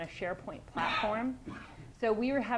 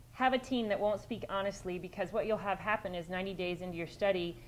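usual speaking voice

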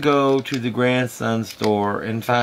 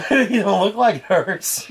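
ordinary delivery

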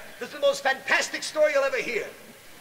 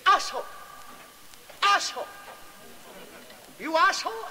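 mock Japanese accent; 7 semitones higher than usual. Japanese swordsman voiced in joke.

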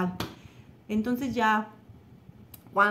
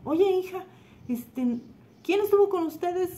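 higher-pitched retold mom voice; mother questioning; 10 semitones higher, 3 dB louder than usual. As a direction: call to the child in a lifted, inquiring tone. (voz aguda de mamá que pregunta)

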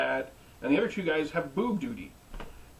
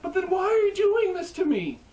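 a pained, higher plea, louder; pushing back at the man who hurt her; upset niece; 4 dB louder than usual. Retold or imitated. Niece pleading with her uncle, a high, upset cry.

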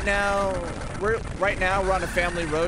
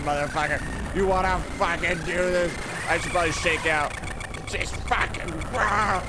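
ordinary talk

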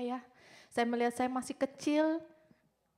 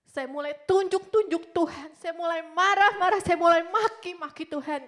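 raised, loud, heated retelling; angry outburst; 5 semitones higher, 12 dB louder than usual. Retold or imitated. Speaker re-living how she railed at God, heated anger. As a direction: build to a loud, indignant peak.

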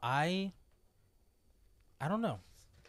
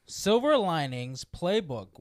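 very slow, loud, each word stretched; loud, drawn-out careful enunciation; over-deliberate retry; 10 dB louder, much slower than usual. Real, not acted. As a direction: enunciate every word with exaggerated care. Careful retry after tongue slip.

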